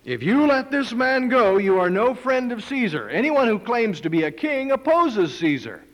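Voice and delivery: high, loud accusing cry; crowd shouting. Acted. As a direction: shout it as a threat to Pilate.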